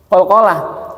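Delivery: usual speaking voice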